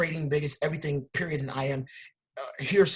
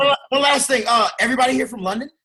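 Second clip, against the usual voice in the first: hyped up; 8 semitones higher, 10 dB louder, faster than usual. Real, not acted. Addressing London crowd, hyped.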